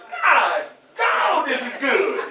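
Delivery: hammed-up bliss at a first bite; savoring food. Speaker replays a hungry person's first bite, hammed-up savoring. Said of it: "you started eating it".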